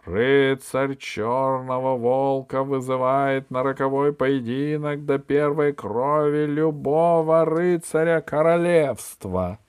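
slow, loud, high proclamation; piercing herald call. Acted. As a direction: announce it across the tournament field. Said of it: "Пронзительный голос судьи возвестил".

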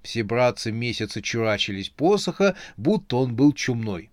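ordinary delivery, nothing marked

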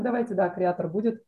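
ordinary talk

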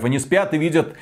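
ordinary talk, nothing marked

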